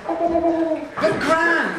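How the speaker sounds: put-on high, excited child crying out; children protesting to their gran; kids' voices. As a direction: squeal it like a bunch of kids. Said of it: "those kids' voices you were really good at doing".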